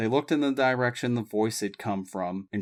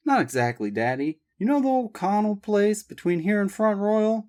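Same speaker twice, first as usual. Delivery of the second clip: lightly higher daughter's voice; Abby voiced; 11 semitones higher, 3 dB louder than usual. A narrator's reading. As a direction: ask her father eagerly. Abby to father.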